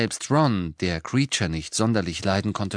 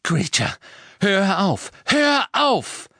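shouted command, climbing higher and louder; urgent shout; 11 semitones higher, 5 dB louder, slower than usual. A narrator's reading. Boy ordering the elf to stop hurting himself, rising shout. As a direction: yell for him to stop. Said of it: "rief Harry".

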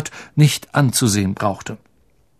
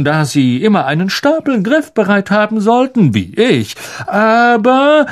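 high, showy condescending lecture; vain teacher, patronizing; 3 semitones higher than usual. Acted. Lockhart lectures Harry privately, patronizing. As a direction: lecture him like a smug star.

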